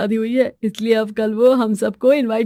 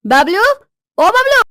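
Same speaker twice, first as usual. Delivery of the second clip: high shout from another room; loud, rising summons; calling out loudly; 10 semitones higher, 6 dB louder than usual. Acted. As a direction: holler for your husband. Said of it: "तुम ऐसे क्यों मेरा नाम चिल्ला रही हो?".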